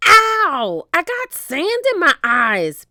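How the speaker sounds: high, loud whiny pirate cry; loud high-pitched pirate whine; whining pirate. A narrator's reading. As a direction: whine it like a big baby. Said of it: "Pinky whined".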